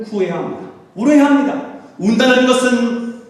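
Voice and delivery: urgent pulpit exhortation; impassioned preaching. Real, not acted. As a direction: call the congregation to weep, voice lifted. Exhorts congregation to weep.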